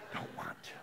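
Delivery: a soft unvoiced aside; whispered mock confession. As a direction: breathe it out as a joke. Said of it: "aside after laugh".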